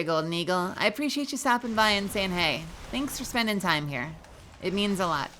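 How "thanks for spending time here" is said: ordinary talk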